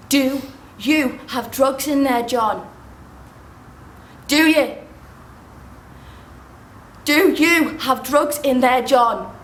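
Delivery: slow, emphatic, insistent demand; angry interrogation. Acted. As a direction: press every word, slowly and angrily. Angry interrogation about hidden drugs.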